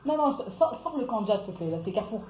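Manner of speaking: low-volume embarrassed aside; hushed aside. Real, not acted. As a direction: drop your voice for someone off-stream. Aside to someone off the live.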